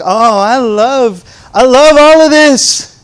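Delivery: loud, high, drawn-out rapture; mock gushing. Preacher plays people acting like cats, gushing loudly. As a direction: belt it out in exaggerated adoration. Voices gushing cat-like believer.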